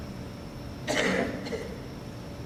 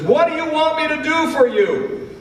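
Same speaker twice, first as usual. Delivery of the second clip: high, loud call across the street; Jesus calling to the blind men from across the street, high loud call; 4 dB louder than usual. Acted. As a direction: project it across the road to them. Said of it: "he calls out to them from across the street".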